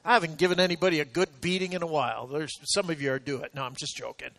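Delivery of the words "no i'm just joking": ordinary talk, nothing marked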